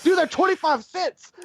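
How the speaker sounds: squeaky high imitation of a buddy; high mock quote. Friend insisting beer is cheap, high mocking voice. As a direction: pitch way up mimicking him.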